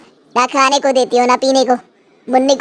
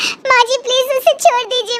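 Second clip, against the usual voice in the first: desperate crying out; 11 semitones higher than usual. Acted. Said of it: "जोर जोर से चिल्लाती है".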